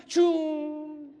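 high, loud "choom" of a spoon plane; playful imitated flying noise; airplane swoosh. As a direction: swoop it in like a toy plane. Spoon plane flies into mouth.

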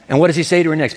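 normal talking voice

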